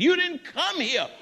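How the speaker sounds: high, loud preaching cry; preaching shout. Real, not acted. Preacher scolds the congregation with a loud cry. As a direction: ring it out from the pulpit.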